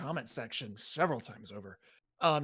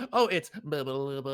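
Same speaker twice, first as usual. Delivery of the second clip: flat robot voice saying blah; 5 dB louder than usual. Retold or imitated. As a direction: drone it like a robot. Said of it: "we'll do that robot voice again".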